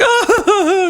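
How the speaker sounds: high, loud yelp laughed through; a shrieked Ah broken by laughter; mock terrified yelp. The scared coworker shrieking at Arthur, mock and laughing. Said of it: "He starts crying".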